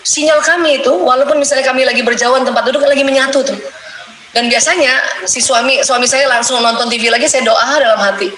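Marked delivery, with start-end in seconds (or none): none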